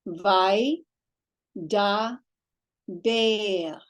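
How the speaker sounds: "Vai" as one syllable, then "da", then "ber". Shown as a demonstration. Teacher showing a merged first syllable, "Vai, da, ber" spaced apart. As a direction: sound out "Vai, da, ber" in separate beats. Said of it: "being made into one syllable".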